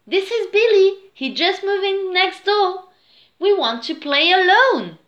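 high, loud little-sister voice; little sister Louise, bossy. A narrator's reading. Louise to her brother with a new playmate, bossy. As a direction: announce it high and insistent.

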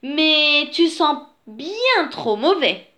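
high, slow storybook complaint; disgusted she-bear. A narrator's reading. She-bear tells the dirty bear he smells, disgusted.

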